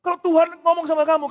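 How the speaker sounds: ordinary delivery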